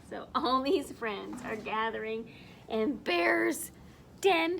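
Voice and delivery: plain reading voice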